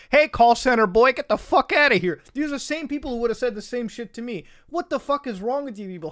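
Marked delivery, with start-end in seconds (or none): 0.1-2.2 louder, higher mock insult; mock yell. Imitating hostile Twitter users insulting him, loud mock yell. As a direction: bark it like a hostile stranger online. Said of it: "they'd be like".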